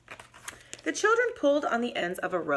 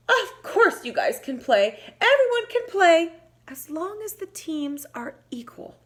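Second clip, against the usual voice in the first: louder, bolder little mouse; bravest voice; 8 semitones higher, 5 dB louder than usual. Acted. Mouse speaking bravely to Bobcat and Wolf, higher and louder. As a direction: put on a brave face and speak up. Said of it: "in her bravest voice".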